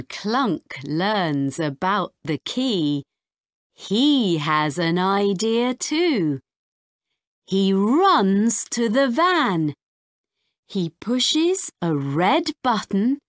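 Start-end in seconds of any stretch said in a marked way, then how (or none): none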